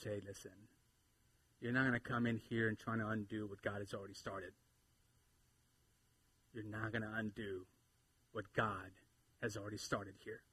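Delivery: low, soft, firm warning; understated, steady authority; quiet assertiveness. Acted. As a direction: lay down the boundary calmly and quietly. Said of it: "just picture the assertiveness of".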